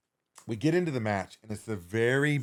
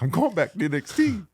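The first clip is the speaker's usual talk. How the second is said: high, mock-tearful whine; comic high-voiced despair; trying not to cry; 8 semitones higher than usual. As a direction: imitate yourself on the edge of tears. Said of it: "I'm trying not to cry".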